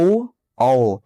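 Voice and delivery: ओ and औ said apart, each vowel drawn out for the class; demonstrating vowel sounds. Shown as a demonstration. Teacher sounding out ओ and औ for students, clearly separated. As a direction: sound out ओ then औ slowly so students hear both. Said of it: "जैसे आप एक बार बोल के भी देख सकते हैं".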